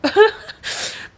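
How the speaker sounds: high breathy laugh close to crying; real emotional laugh; teary laugh. Real, not acted. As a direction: laugh that nearly tips into tears. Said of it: "And I'm, like, so emotional".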